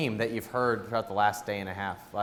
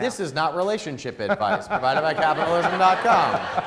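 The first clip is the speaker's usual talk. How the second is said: laughing; 7 semitones higher, 6 dB louder than usual. Real, not acted. Joking disclaimer to audience.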